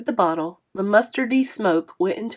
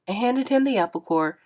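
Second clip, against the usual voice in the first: ordinary delivery, nothing marked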